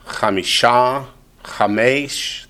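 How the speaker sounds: Chamisha and Chamesh rising onto their final syllables; end-stressed Chamisha, Chamesh; stress on last syllable. Shown as a demonstration. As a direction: slowly lift "sha" in Chamisha and "mesh" in Chamesh. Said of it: "they both accent the last syllable".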